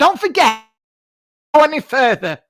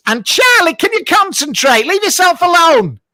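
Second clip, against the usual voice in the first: louder, higher mock telling-off; mock scolding; 5 semitones higher, 4 dB louder than usual. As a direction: scold your sidekick in comic exasperation.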